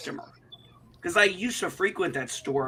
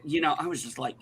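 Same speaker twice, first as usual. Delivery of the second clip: usual speaking voice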